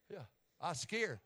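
ordinary talk, nothing marked

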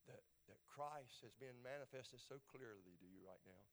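soft, hushed appeal to the listener; softened, unhurried pulpit voice; gentle altar call. Real, not acted. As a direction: preach it quietly with long pauses.

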